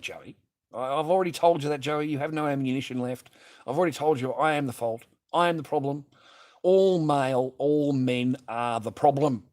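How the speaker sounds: loud, mock-confessional declaration building in force; sarcastic agreement. Real, not acted. Streamer answering Joey's blame on all men, loudly sarcastic. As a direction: agree with the accuser, loudly and bitterly.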